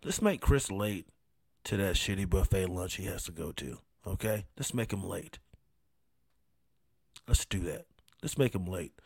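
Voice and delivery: low, hushed plotting between gods; scheming podcast gods. Acted. Podcast gods conspiring to make the host late, scheming quietly. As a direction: plot it quietly, low and soft, with pauses. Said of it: "the podcast gods are like".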